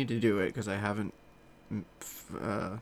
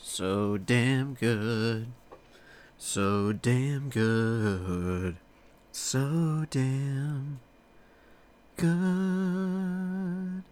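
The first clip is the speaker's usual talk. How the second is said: drawn-out savoring; 3 semitones higher, much slower than usual. Real, not acted.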